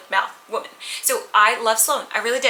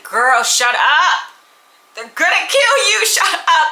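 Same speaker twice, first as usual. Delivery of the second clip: put-on higher, louder, slow "Girl, shut up"; exasperated yell; 7 semitones higher, 7 dB louder, slower than usual. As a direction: yell at the book character to stop talking. Yelling at a reckless character.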